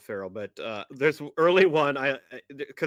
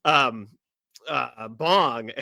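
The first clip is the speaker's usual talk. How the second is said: normal talking voice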